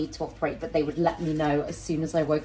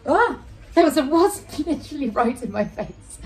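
sudden high startled laugh, right in her face; jolted, laughing as a wasp buzzes past; real fright at a wasp, laughing it off; 8 semitones higher, 6 dB louder than usual. Real, not acted.

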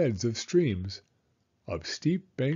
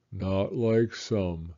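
very slow, low, sulky voice; glum donkey; 4 semitones lower, much slower than usual. Acted. Eeyore hints at others who let him down, slow and glum. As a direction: mutter it like a hurt old donkey.

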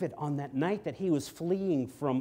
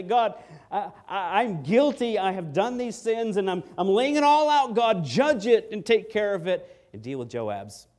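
a higher, louder prayer, building as it goes; pleading confession; 4 semitones higher, 4 dB louder than usual. Acted. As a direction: cry out your guilt to God. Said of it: "You need to say".

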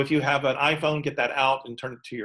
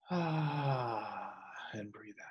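audible sigh, then a whispered exhale; slow deep breaths; 5 dB quieter than usual. Shown as a demonstration. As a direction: guide a big breath, softly. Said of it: "A much bigger breath".

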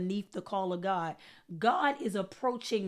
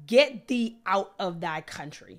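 a slow, weighty divine command, leaning on GET; emphatic command; 5 dB louder, slower than usual. Acted. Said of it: "with some level of emphasis".

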